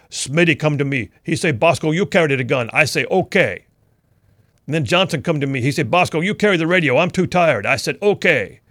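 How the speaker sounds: imitated Malawian English accent; Bosco's Malawian accent. Bosco explaining the extra gear to his squad leader, imitated Malawian accent. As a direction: retell it in a put-on Malawian African accent. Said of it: "In his awesome accent".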